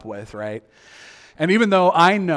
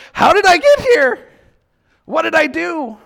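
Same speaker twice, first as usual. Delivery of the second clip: high, loud bewildered cry; a mock-panicked wail of disbelief; 13 semitones higher, 9 dB louder than usual. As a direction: cry out, lost and confused. Bewildered at ending somewhere bad.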